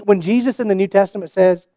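usual speaking voice

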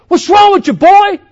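hammed-up angry sports dad; mock shouting dad. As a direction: yell it from the stands like an obnoxious father. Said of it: "He would say".

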